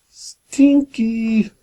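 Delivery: playful high squeak on "stinky"; squeaky stinky. Acted. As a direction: squeal "stinky" to make the child laugh. Playful reading of funny word.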